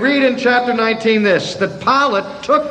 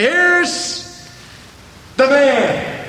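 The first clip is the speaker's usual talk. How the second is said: high, drawn-out mock introduction; derisive; 5 semitones higher, much slower than usual. Pilate making sport of Jesus before the crowd, derisive. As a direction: announce him in derision, pitched well up. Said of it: "of derision".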